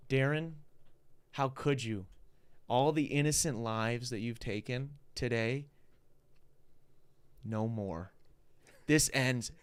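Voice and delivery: mock-dramatic showdown with long pauses; feed the line like an anime hero facing a villain; slow, building to an emphatic finish. Shown as a demonstration. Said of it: "pretend you're in the booth".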